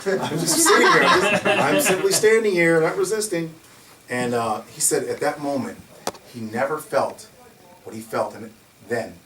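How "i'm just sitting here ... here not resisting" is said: high, laughing mock plea; laughing imitation. Teller laughing while voicing the guard's protest to police, high.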